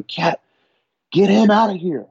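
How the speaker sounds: high, pressing call to the captain; retelling how he begged the captain to get the guy away, urgent and high; urgent plea. Retold or imitated. Urgent plea to captain mid-CPR.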